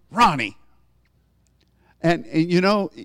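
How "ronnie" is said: a mother's warning, name said firmly; stern mom calling name. Retold or imitated. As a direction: call the child's name as a reprimand. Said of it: "my mom".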